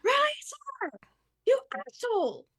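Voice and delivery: high, loud yell to carry far; shouting across water. Acted. Player's character yells at the creature across the water, high furious shout. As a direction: holler it at a distant foe. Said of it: "shouting across the water".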